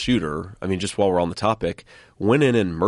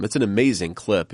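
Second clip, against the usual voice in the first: usual speaking voice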